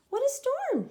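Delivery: high twittering bird voice; twittering wren. Acted. Wren flutters in from the storm, high twitter. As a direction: chirp it like a little wren. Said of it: "twitters wren".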